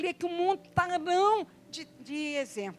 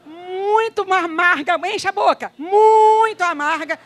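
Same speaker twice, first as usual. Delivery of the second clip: preaching at full force; 3 semitones higher, 12 dB louder than usual. Real, not acted. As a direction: hammer each word of bitter. Preaching bitter water loudly.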